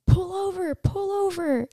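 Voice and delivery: high, urgent imitation of a scared passenger; panicked friend. Melissa urging the driver to pull over, high and frantic. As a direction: squeal it like a frightened friend in the back seat. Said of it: "And Melissa's like".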